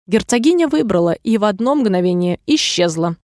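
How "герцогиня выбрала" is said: ordinary delivery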